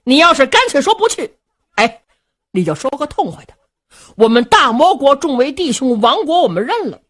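high, loud, fast heated scolding; fuming brother accusing Luo Cheng of sitting on the fence; angry tirade. A narrator's reading. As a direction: rattle off the accusation in rising fury. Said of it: "双眉倒竖， 二目圆睁".

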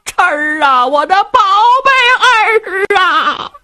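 high, drawn-out wailing cry; wailing old mother. Acted. Old mother to her bound son, slow wailing. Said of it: "老太太哭啊".

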